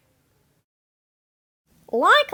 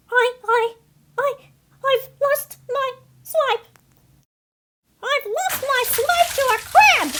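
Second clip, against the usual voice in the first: higher, crying, hammed-up despair; mock sobbing; 4 semitones higher than usual. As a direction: wail over the lost snack. Seagull lost snack to crab.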